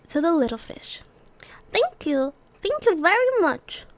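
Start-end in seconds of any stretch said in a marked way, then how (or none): 1.7-3.9 grateful. Acted. Little fish thanks happily.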